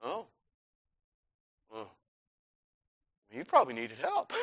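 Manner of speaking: soft, low, halting realisation; acted embarrassed backing down; sheepish lifeguard. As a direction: mumble it with long awkward gaps.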